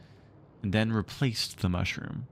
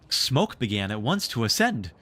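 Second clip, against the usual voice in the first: ordinary delivery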